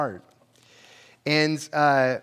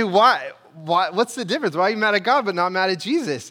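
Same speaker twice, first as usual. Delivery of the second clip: louder, higher and fast, pressing "Why? Why?"; insistent questioning; 5 semitones higher, 5 dB louder than usual. Acted. Preacher voicing his challenge to an angry believer, loud and urgent. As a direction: push back hard at the questioner.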